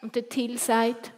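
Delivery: ordinary delivery, nothing marked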